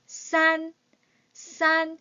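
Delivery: 싼 with a tense s, high and level; model pronunciation. Shown as a demonstration. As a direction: say 싼 like a tensed ssan. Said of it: "된 소리처럼 읽으시면 돼요".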